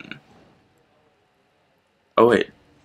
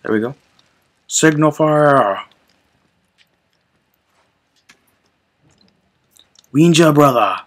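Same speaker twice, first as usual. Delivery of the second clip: caveman voice; 6 semitones higher, 3 dB louder than usual. Acted. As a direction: bellow like a caveman. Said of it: "caveman voice".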